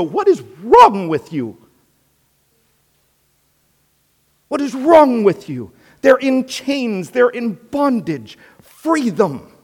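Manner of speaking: high, loud, incredulous outburst; exasperated scolding. Acted. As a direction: plead indignantly for people in chains. Acted exasperated bystander scolding the yeller.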